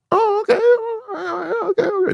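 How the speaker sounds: very high, squeaky sheepish okays; voice all high. Retold or imitated. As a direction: go way up high like you know you messed up. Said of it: "when your voice gets all high like that".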